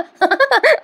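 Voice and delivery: a bright high-pitched giggle; real laugh at her own joke. Real, not acted. Recapper laughs at her joke about Priya.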